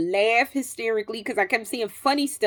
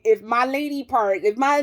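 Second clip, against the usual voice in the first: ordinary talk, nothing marked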